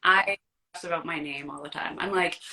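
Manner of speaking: ordinary talk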